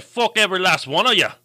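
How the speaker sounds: hammed-up Scottish cursing; bad Scottish accent. Voicing the new listener, loud bad Scottish accent. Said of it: "That, that's not".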